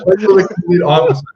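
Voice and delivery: laughing. Real, not acted. Laughing while speaking.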